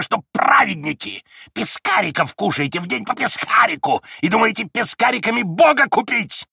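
high, loud mocking tirade; furious shouting. A narrator's reading. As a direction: sneer and shout at the monks. Old man shouting at monks.

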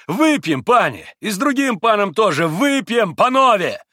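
loud, high-pitched invitation to drink; rally everyone boisterously; exuberant shouting. A narrator's reading. Mitya invites the Poles to drink, shouting heartily. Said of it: "крикнул Митя".

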